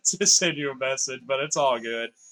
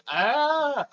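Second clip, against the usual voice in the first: surprised yelp; 12 semitones higher, 5 dB louder than usual. Real, not acted. Sudden game moment.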